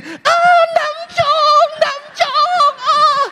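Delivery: high squealing frenzied fangirl; hammed-up fangirl frenzy; mock possessed fan squeal. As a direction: shriek like a possessed teen fan. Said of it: "Dia langsung gini".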